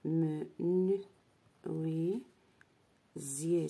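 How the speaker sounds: me, nu, oui, zier, each syllable separate; teacher sounding out menuisier in pieces; split into syllables. Shown as a demonstration. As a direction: break the word into me, nu, oui, zier. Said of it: "je change pour obtenir le mot".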